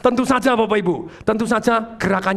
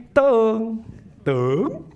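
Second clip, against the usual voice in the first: gentle call. Shown as a demonstration. Trainer showing the same word in another tone, gentle call. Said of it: "Tapi kalau misalnya ngomong gini".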